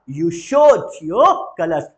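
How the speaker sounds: slow and loud, "your" hit hard; loud model phrase. Shown as a demonstration. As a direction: belt out "You showed your colors". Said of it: "बोलेंगे जरा". (ज़ोर से "You showed your colors")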